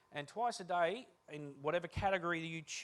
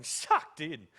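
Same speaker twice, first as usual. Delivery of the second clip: mock taunt; 9 dB louder than usual. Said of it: "mates around a speakerphone going".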